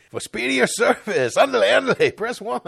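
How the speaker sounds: high mock Mexican cartoon voice; Speedy Gonzalez. Host as hotline offering speedier service, high cartoon accent. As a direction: play an excitable Mexican-accented cartoon operator. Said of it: "like, Speedy Gonzalez or somebody".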